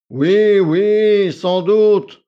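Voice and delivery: loud, high, offhand assent; lofty, patronising yes; condescending. A narrator's reading. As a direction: deign to answer her. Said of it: "daigna-t-il enfin répondre".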